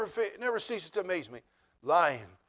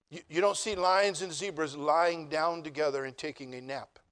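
ordinary talk, nothing marked

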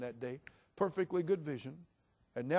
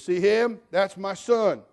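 loud, high-pitched proud boast; jailed grandfather; 6 semitones higher, 13 dB louder, slower than usual. Retold or imitated. Grandfather pointing out his son to preacher, loud boast. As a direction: mimic a grandfather showing off loudly. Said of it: "the grandfather said".

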